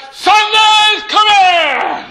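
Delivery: high, long roar of a congregation; shouted response. Retold or imitated. A thousand people answering the pastor, long jubilant shout. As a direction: shout it back huge, stretching every word. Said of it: "a thousand people shouted back".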